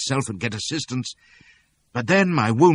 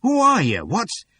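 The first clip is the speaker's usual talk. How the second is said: alarmed townsman; 12 semitones higher, 5 dB louder, slower than usual. A narrator's reading. Townsman questioning.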